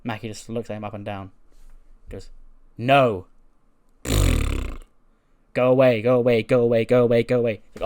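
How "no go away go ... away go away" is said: loud, repeated shooing away; loud curt brush-off; loud dismissal. Retold or imitated. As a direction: shoo him off loudly. Wrestler dismisses Truth.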